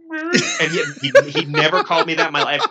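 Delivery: laughing through the words. Real, not acted. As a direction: breaks into laughter mid-sentence. Laughing while telling the story.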